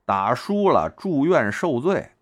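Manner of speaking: slow, deliberate admonishing; slow police warning. Retold or imitated. Police warning men against fighting, slow and deliberate. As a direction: spell the warning out slowly like a policeman. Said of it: "警察叔叔那句话说得明白啊".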